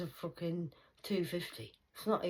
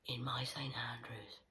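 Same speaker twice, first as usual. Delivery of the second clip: soft hushed whisper; whispered taunt; 3 semitones lower, 9 dB quieter than usual. Acted. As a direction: murmur the jabs like a secret.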